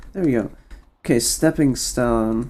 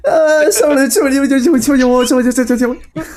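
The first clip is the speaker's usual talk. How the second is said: much higher, loud squeaky gibberish Morty; fake Arabic Morty voice; 11 semitones higher, 6 dB louder, much faster than usual. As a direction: squeal it way up high. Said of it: "The same, but in a higher pitch".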